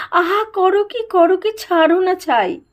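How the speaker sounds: high, hurried plea; panicked protest. A narrator's reading. Nanda seized by the throat by a sahib, panicked protest. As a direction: yelp it out high and fast, begging release.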